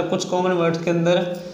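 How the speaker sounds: usual speaking voice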